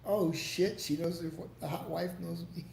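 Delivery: ordinary delivery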